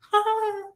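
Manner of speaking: high-pitched swoony "ha"; imitated reaction. Woman mimics the women she flirts with, high exclamation. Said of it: "And they're like".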